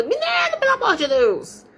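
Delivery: put-on exasperated high yell at the kid; a mom's half-joking shriek, pitch way up; little yell. Mother exasperated with her son, high mock little yell. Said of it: "uns gritinhos".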